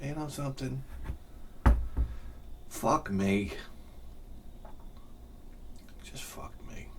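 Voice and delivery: hushed, beaten-down mutter to himself; barely audible resigned whisper; muttered defeat. Retold or imitated. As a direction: sink into quiet despair. Muttered defeat after seeing teacher.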